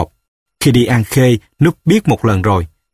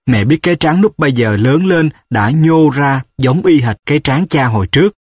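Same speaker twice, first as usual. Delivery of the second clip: plain reading voice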